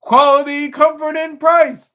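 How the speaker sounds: high, loud, slow slogan voice; ad announcer. Retold or imitated. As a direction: sell it like a TV commercial. Mimicking an ad slogan.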